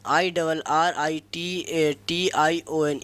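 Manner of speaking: slow dictation of ए एन जी ई आर; spelled out letter by letter. Shown as a demonstration. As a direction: spell ए एन जी ई आर slowly, each letter apart. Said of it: "irritation". (धीरे-धीरे अक्षर-अक्षर बोलना)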